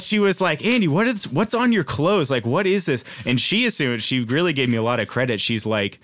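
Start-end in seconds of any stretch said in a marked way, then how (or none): 0.6-3.0 high, sharp scolding mom voice; angry mom. Retold or imitated. Mom scolding her son about his clothes, high and angry. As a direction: demand answers like a furious mom. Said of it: "my mom, really angry".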